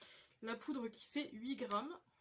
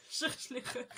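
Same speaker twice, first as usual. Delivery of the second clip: loud, amused, through real laughter; laughing; 4 dB louder than usual. Real, not acted. Make-up vlogger mocking her own skills, laughing. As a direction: get the words out while laughing.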